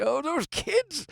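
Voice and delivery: high, drawn-out mock complaint; hammed-up scorn for the young; mock disapproval. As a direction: grumble slowly in a high put-on voice. Acting out complaint about youngsters' language.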